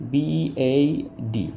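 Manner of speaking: বিএইডি spelled letter by letter, very slow; slow spelling out. Shown as a demonstration. Teacher to students, spelling out বিএইডি very slowly. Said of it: "যেমন".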